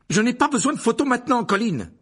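Harry shouting at Colin to stop taking photos; forceful cry. A narrator's reading. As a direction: yell it at the photographer. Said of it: "s'écria-t-il avec force".

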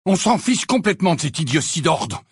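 high, loud outburst; exclaiming angrily. A narrator's reading. Fred exclaims to Sirius, loud and angry. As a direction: lash out at the order. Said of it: "s'exclama Fred".

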